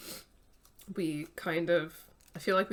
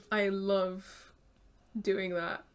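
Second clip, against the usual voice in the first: ordinary talk, nothing marked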